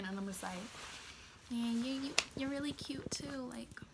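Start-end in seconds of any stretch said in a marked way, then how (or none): 1.5-3.8 soft, higher, halting retold reply; shy compliment. Girl answering the boy's compliment, shy and soft. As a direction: trail into a bashful near-whisper.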